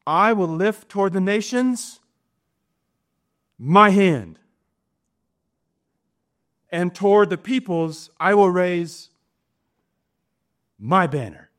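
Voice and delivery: MY hand and MY banner hit hard; stress on MY. Shown as a demonstration. Preacher pressing the emphasis on my. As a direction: lean on every my and I. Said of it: "Catch the emphasis if you can".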